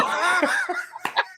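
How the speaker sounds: high-pitched burst of laughter. Real, not acted. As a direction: break into a squeaky laugh. Laughing at phone impression.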